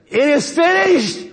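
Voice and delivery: high, drawn-out triumphant cry; dying yell. Acted. Preacher acting Jesus's last cry from the cross, yelled. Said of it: "And he yells out".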